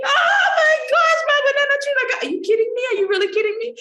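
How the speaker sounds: high, loud hammed-up wailing over a banana tree; mock bawling cry. Shown as a demonstration. Actor shows the bawling cry over a lost tree, mock wailing. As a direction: wail it like a melodramatic sob, sing-song and over the top. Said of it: "what type of cry that".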